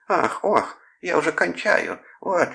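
hammed-up falsetto ecstasy to provoke an onlooker; feigned ecstatic moaning. A narrator's reading. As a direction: moan as if overcome, all for show. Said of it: "притворно простонал Клод".

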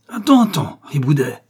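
high, loud angry call; angry shout. A narrator's reading. Priest calling out to an unruly boy, angry shout. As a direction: call out sharply to scold him. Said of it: "cria l'ecclésiastique d'une voix colère".

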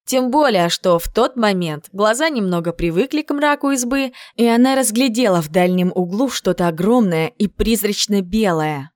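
usual speaking voice

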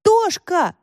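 high, drawn call of a name; narrated yell, only mildly loud. A narrator's reading. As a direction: call out to a friend, pitched right up. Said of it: "Не ори".

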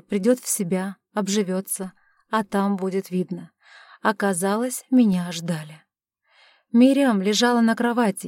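ordinary delivery

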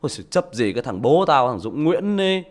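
loud, slow scornful jeer; mocking child. Son mocking his father, loud scornful sneer. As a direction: sneer at the father like a grown kid. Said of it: "nó bảo".

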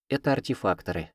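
plain reading voice

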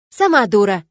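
loud snapping comeback, stress on сама; retort. A narrator's reading. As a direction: fire back at her. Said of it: "не осталась я в долгу". (дерзкий ответ: «Сама дура!»)

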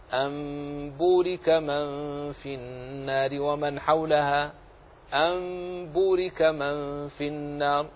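slow Quranic chant, أن بورك as a held am-būrika; chanted أن بورك, noon becoming a sustained meem; iqlab recitation. Shown as a demonstration. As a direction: recite with أن بورك turned to a long hummed m before b. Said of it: "فتقرأ هكذا".

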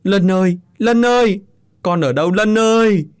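high, loud wailing call; calling out desperately. Acted. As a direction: shout across the fields for the lost son. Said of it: "kêu gào tên thằng con trai". (ông Tứ gào gọi tên con, giọng cao và rất to)

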